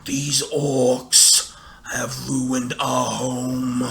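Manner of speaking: a hint of a grave old tree; lightly voiced Treebeard. Treebeard tells the hobbits, slow and grave. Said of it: "he's like".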